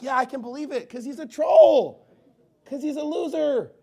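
high, hammed-up exasperated reply; mock exasperation. Preacher answers the troll gossip, exasperated. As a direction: draw out the insult in loud mock disbelief.

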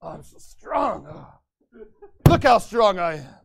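lightly voiced smug show-off with groans; mock bragging. Pastor mocking mask-wearers who brag, a hint of smug bragging. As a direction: preen and sneer like a self-satisfied boaster. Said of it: "brag about that and be like".

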